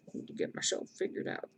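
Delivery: hushed, near-whispered self-reminder; muttering to herself. Real, not acted. Streamer reminding herself to get organized, muttered softly.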